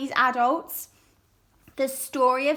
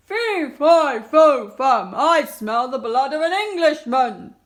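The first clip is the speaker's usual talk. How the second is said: booming giant bellow, much louder; giant calling; 10 dB louder than usual. Acted. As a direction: roar after Jack like a huge giant.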